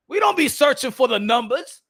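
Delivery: ordinary delivery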